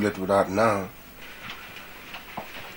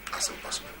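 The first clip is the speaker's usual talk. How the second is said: a whisper; whispered refusal; 13 dB quieter than usual. Acted. Husband refusing his wife, whispering. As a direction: breathe it out, no voice.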